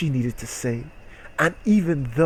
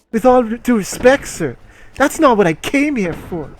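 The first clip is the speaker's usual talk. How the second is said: indignant reporter; 9 semitones higher, 6 dB louder than usual. Acted. Reading Christy's reply.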